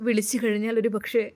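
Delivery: plain reading voice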